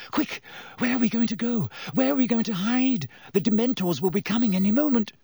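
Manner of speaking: scrambling for a hiding place; panicked Hermione. A narrator's reading.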